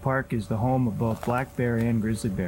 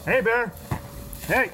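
shouting to scare off a bear; 10 semitones higher than usual. Real, not acted. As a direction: call out to warn a bear. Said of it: "as you just saw, I clapped, made some noise, shouted out".

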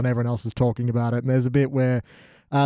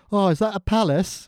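put-on higher, dim-witted northern English accent; idiot northern English character; 4 semitones higher than usual. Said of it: "our idiot northern character".

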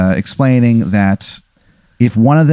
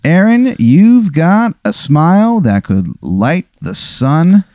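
gushing compliment; 6 semitones higher, slower than usual. Acted. Vegetable flatterer voiced by GM.

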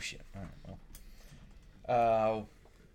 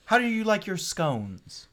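lightly higher, slow genteel host; a hint of a prissy captain; prim captain; 9 semitones higher, slower than usual. Captain offers tea to spy.

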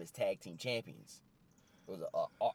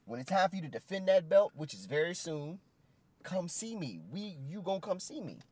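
higher and louder, calling him out; rising challenge; 9 semitones higher, 4 dB louder than usual. Retold or imitated. Keith Lee calling out the champion, high and loud. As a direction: push it up high like a wrestling promo.

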